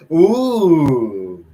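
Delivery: high loud startled ooh; a sudden real surprise; surprised ooh. Real, not acted. Reader caught off guard mid-story, loud surprised ooh. Laughing aside, surprised exclamation.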